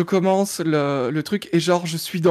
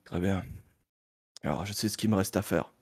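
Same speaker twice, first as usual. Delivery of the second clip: very low, subdued film-noir hero; grave detective; 9 semitones lower, 10 dB quieter than usual. Acted. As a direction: take the case in a deep, quiet voice. Detective Malone takes the case. (détective ténébreux, voix basse et grave)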